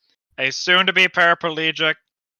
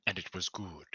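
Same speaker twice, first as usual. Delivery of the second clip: solemn hush; 11 semitones lower, 16 dB quieter than usual. Acted. Echoing solemnly.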